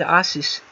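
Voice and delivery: "Asus" pronounced AH-sis, stressed on the first syllable. Shown as a demonstration. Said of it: "I don't know how you pronounce that".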